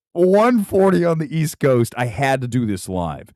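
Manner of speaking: ordinary talk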